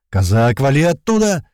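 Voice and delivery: loud order climbing high; urgent shout. A narrator's reading. Leader radioing the pilot to flee, urgent shout. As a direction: shout him out of danger. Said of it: "ещё раз прокричал Туман".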